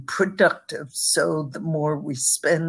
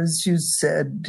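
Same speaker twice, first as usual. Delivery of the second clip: ordinary talk, nothing marked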